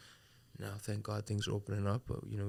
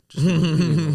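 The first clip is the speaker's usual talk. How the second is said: high, loud mock snap; mock outburst; 6 semitones higher, 13 dB louder than usual. Turning down a night out, high, loud mock snap. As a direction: shout it at the friend who calls. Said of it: "and I say".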